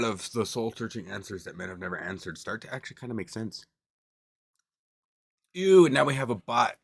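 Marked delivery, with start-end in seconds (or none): 5.5-6.8 disgusted. Real, not acted. Bot spam appears in chat.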